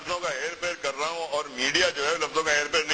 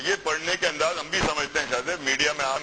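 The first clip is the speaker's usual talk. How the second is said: ordinary talk, nothing marked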